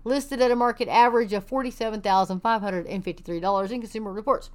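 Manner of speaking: ordinary delivery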